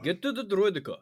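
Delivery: loud, high French Droideka; French accent. Retold or imitated. Echoing the French word.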